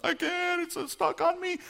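put-on loud, very high-pitched child wail; hammed-up wailing three-year-old; crying little girl. Three-year-old tells uncle her hand is stuck, mock crying wail. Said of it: "she starts crying".

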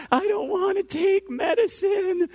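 put-on high, loud, drawn-out toddler wail; tearful child. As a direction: imitate a little girl wailing in protest. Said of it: "Instantaneous tears just start shooting".

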